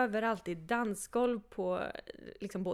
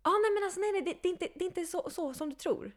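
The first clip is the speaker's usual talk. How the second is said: higher, lightly voiced eager host; 9 semitones higher than usual. Host waving away her worry about drinks, higher voice. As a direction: play the host brushing off worries.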